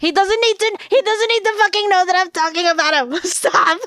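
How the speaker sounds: high, loud, rushed plea to stop; flustered protest. Real, not acted. Frantically stopping someone telling on her.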